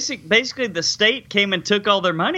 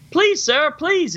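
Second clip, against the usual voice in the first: much higher, hammed-up plea from the floor; mock begging; 9 semitones higher, slower than usual. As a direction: beg the attacker, mockingly. Alex on ground begging.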